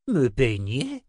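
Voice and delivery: swooping, affronted question; indignant. A narrator's reading. Old wizard balks at being combed, indignant. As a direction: repeat it as an offended echo, rising sharply at the end. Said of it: "répéta-t-il indigné".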